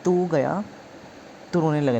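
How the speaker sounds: ordinary talk, nothing marked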